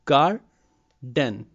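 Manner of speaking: "garden" split as "gar", pause, "den"; syllable by syllable. Shown as a demonstration. Teacher showing two vowel sounds in garden, split apart. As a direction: separate "gar" from a full "den". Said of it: "two syllables".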